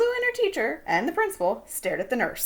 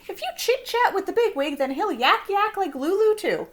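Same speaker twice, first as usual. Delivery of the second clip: high, raised-voice warning; shouted warning; 6 semitones higher, 3 dB louder than usual. A narrator's reading. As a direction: warn them urgently in a high voice. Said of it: "shouted the nurse".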